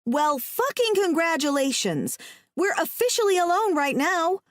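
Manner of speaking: high, angry sarcasm; shocked and upset. A narrator's reading. Woman to trapped man who wanted time alone, upset. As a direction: lash out in upset disbelief. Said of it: "I'm so shocked and upset".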